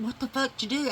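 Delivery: mock shock at the mess; alarmed. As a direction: friend rushing in alarmed. Alarmed friend rushing in.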